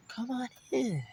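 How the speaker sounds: hushed fake welcome. Imitating his mom plotting at the door, hushed and sly. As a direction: lure him in softly, calculating. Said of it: "very meticulous in how she's gonna murder me".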